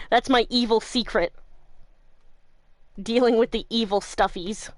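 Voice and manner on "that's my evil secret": ordinary talk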